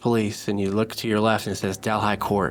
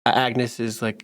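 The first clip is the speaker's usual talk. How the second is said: normal talking voice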